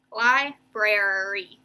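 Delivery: "Library" slow, in stretched syllables. Shown as a demonstration. Teacher finding where to break it, "Library" slowly sounded out. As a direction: draw out each part of "Library". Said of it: "gonna break it after brer".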